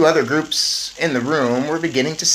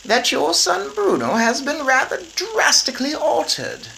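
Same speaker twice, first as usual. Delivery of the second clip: put-on higher, careful old lady; grandmother's voice. Grandmother tells the parents about Bruno, high and careful. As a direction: break it to them politely. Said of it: "my grandmother said".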